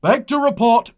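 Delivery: high, loud military shout; parade-ground bark. Acted. Centurion reports to commander, barked. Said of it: "barked in best parade ground manner".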